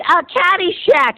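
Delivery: put-on high, slow little-kid answer. Young boy answering the babysitter, high and eager. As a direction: chirp it like an eager eight-year-old. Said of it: "And I said".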